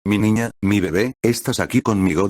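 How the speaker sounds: ordinary delivery, nothing marked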